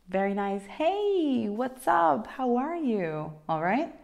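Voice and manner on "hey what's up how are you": high, breezy hello to a pal; friendly greeting. Shown as a demonstration. Teacher translates meeting a friend, high friendly greeting. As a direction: open high and cheery, easing down. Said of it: "when you are greeting somebody".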